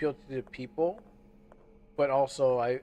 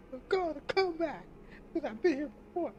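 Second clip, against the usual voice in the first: silly high voice. Pretend. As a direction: squeak the line out in a goofy falsetto. Lyric redone in a silly voice.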